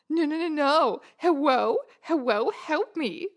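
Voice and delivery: cutesy uwu voice turning l into w, hewwo; uwuified pleading. Acted. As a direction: plead in a cutesy baby-talk lisp. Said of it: "uwuified words".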